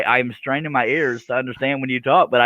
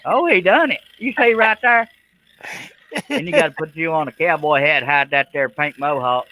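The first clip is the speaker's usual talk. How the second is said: higher, hammed-up Texan; Texan accent; 5 semitones higher than usual. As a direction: tease in a playful Texan voice. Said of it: "needs to talk in Texan".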